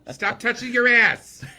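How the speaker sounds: slow, very high and loud mock mom screech; mother yelling, laughing. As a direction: stretch out the mother's scold while laughing. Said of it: "My mother would be like this".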